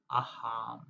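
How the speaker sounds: soft, low, breathy aham on the exhale; breathed mantra. Shown as a demonstration. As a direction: let aham float out on the breath. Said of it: "say or think of the phrase, aham".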